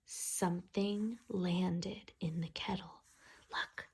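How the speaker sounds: breathy hush of suspense; soft suspense whisper. A narrator's reading. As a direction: whisper it like a secret. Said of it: "reading to children, hushed".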